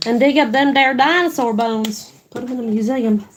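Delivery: playful Southern twang; put-on Southern US accent. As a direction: slip into a folksy Southern accent for fun. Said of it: "Don't know why I was southern there for a minute".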